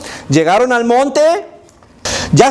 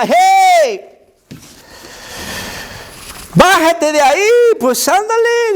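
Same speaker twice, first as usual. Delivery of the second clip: mocking shout; 11 semitones higher than usual. Crowd jeering Jesus on the cross, shouting mockery. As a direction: taunt him from below the cross. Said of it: "le gritaba y le decía".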